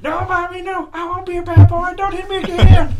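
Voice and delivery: put-on high, loud crying child voice; child pleading. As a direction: beg like a scared little boy. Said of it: "he's like".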